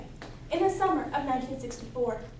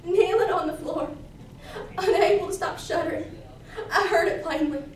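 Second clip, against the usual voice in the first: louder, breath still catching from sobs; shuddering tearful narration; 5 dB louder than usual. Acted. Girl kneels after her confession, shuddering and tearful. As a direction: keep narrating through the aftershocks of crying.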